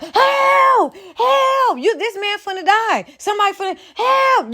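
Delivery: high, frantic calls for help; panicked screaming for help. Shown as a demonstration. Acting out real panic when a man is dying at home, high frantic screams. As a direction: scream like someone's dying in front of you. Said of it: "you screaming like a mud".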